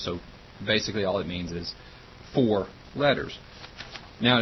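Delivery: ordinary talk, nothing marked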